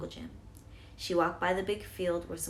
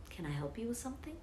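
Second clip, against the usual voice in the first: whispering; 5 semitones higher, 6 dB quieter, faster than usual. Acted. Clerk speaks to frightened girl.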